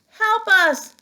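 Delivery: high, loud plea for help; cry for help. Acted. Missus Watson calling from the broken floor, loud and high. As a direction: call out desperately.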